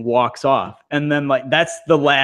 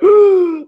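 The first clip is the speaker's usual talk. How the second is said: sharp shocked gasp, much higher and louder; 8 dB louder than usual. Retold or imitated. Host reacting to his own headline, shocked gasp. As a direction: gasp at spotting your headline. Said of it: "I was like".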